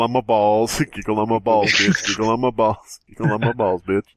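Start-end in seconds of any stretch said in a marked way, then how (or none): none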